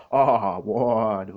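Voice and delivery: loud cry as if shot in the heart; pained shout. Acted. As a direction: let it burst out like a wound. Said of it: "gritó el mosquetero". (grito dolido, más fuerte)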